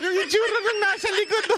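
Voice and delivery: normal talking voice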